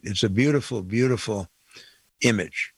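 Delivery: ordinary talk, nothing marked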